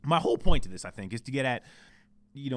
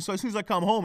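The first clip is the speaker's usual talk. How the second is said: usual speaking voice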